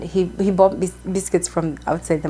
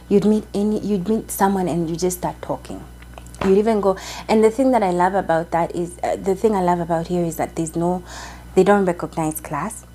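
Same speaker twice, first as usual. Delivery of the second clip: ordinary delivery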